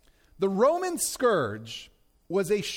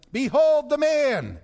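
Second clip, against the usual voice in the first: loud, higher, ringing announcement; acted public declaration, raised voice; proclamation to a crowd; 7 semitones higher, 6 dB louder than usual.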